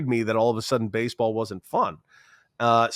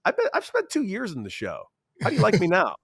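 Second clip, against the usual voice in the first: cocky comeback; 8 semitones higher than usual. Retold or imitated. Teammate snaps back at heckler.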